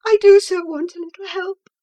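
frightened wife begging her friend for help; crying out. A narrator's reading. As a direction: cry it out, close to tears. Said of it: "she cried".

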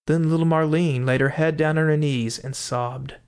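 usual speaking voice

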